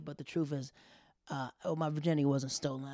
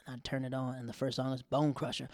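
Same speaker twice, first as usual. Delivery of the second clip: usual speaking voice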